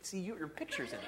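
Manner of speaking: playful, laughing brag. Pretend. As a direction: laugh while showing off the photo. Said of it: "When he opens his wallet and goes".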